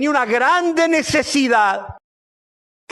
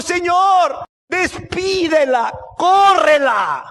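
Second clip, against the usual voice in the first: high, drawn-out shouted demand; acted exasperated shout to send her away; urgent begging; 8 semitones higher, 4 dB louder, much slower than usual. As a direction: plead loudly, stretching each word. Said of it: "le rogaron diciendo".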